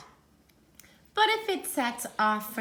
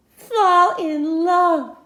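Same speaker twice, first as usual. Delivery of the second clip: swooning; 6 semitones higher, 10 dB louder, much slower than usual. Acted. Romantic reading.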